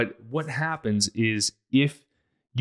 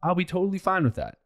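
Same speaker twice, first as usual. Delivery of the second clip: higher, lightly breezy supportive spouse; carefree spouse; 6 semitones higher than usual. Retold or imitated. Spouse happy to stay home, higher carefree voice. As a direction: toss it off easily, no worries at all. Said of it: "and you're like".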